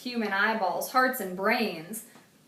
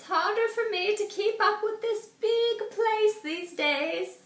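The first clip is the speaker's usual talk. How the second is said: put-on old woman voice; 12 semitones higher than usual. A narrator's reading. Mrs Tibble welcomes Arthur warmly, high old-lady voice. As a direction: offer it sweetly like a lonely grandmother.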